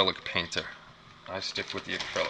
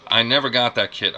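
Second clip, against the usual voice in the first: excited outburst; 3 semitones higher, 10 dB louder than usual. Real, not acted. Envious outburst at viewer's find.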